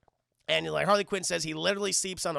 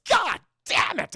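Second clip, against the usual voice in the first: Joker; 10 semitones higher, 9 dB louder, slower than usual. Retold or imitated. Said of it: "who's just so fucking mad".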